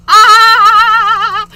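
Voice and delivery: short high loud yelp of alarm; a real startled scream. Real, not acted. Host screams as the lights go haywire.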